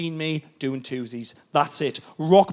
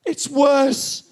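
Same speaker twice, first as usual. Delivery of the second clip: wife voice; 10 semitones higher, slower than usual. Retold or imitated. Wife's voice.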